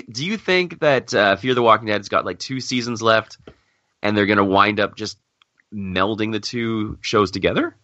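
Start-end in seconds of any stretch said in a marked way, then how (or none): none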